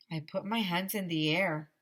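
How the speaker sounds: usual speaking voice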